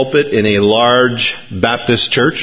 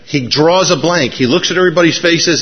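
normal talking voice

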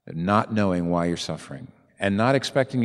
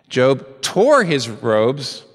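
building; 5 semitones higher, 7 dB louder, much slower than usual. Acted. Preacher rising in intensity.

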